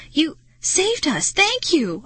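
high-pitched, relieved exclamation; heartfelt high cry of thanks; grateful cry. A narrator's reading. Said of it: "I cried".

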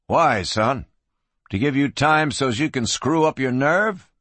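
ordinary delivery, nothing marked

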